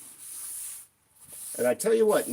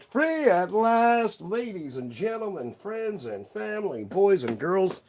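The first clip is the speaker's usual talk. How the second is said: higher, slow ringmaster call to the crowd; showman announcement; 7 semitones higher, 3 dB louder, slower than usual. Pretend. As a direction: proclaim it like a hammed-up circus host. Hammed-up proclamation to audience.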